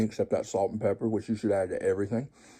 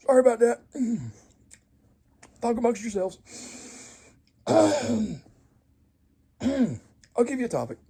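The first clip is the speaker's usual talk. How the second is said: comic flustered voice; 12 semitones higher, 3 dB louder than usual. Acted.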